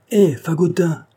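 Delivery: put-on loud, high call to a boy; mother hollering a name. A narrator's reading. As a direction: shout for the lad, pitch falling away. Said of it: "criait la bonne femme".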